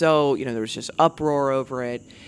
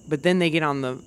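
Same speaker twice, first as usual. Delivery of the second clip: ordinary talk, nothing marked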